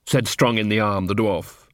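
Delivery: plain reading voice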